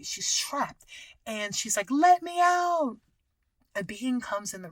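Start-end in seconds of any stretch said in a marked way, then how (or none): 1.9-3.0 drawn-out, high, loud plea; acted yell to be freed; desperate cry. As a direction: call out as if trapped. Acting out trapped, desperate woman.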